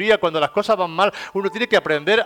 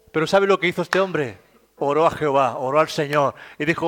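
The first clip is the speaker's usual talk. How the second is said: usual speaking voice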